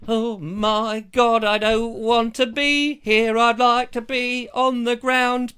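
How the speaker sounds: comic drawn-out panic; mock fear of heights. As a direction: wail it like you're stuck up the tower. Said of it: "And you're going".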